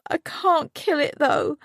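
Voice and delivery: high, tearful refusal; crying. A narrator's reading. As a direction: refuse through sobs. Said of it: "She burst into tears".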